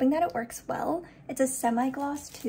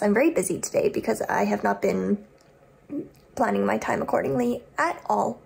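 usual speaking voice

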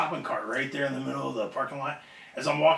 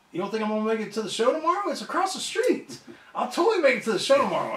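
incredulous protest; 6 semitones higher than usual. Retold or imitated. Acted protest to his boss.

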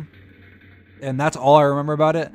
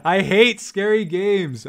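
nervous laughter; 4 semitones higher, much slower than usual. Real, not acted. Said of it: "My heart is beating".

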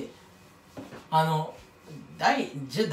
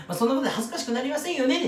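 quick, light and higher, just gliding past; breezy throwaway; 6 semitones higher, faster than usual. Shown as a demonstration. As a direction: toss it off smoothly, no pause. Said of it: "そこはさーっと".

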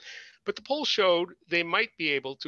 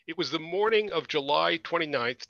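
usual speaking voice

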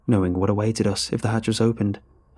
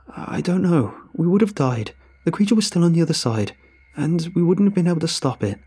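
higher, louder, frantic; stammering; 8 semitones higher, 3 dB louder than usual. A narrator's reading. Narrator defending himself to his captain, frantic. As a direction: blurt excuses in panic. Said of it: "I tried to stammer the right words".

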